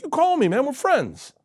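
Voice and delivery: high, mock-offended phone call; playful Will Smith. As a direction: tease him like a hurt friend. Will insisting on friendship.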